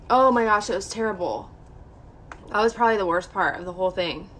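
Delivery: normal talking voice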